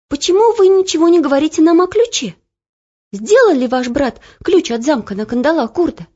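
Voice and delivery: put-on girl anxiously questioning the cook; urgent girl. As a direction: press the cook for news of the key. Olya asks urgently.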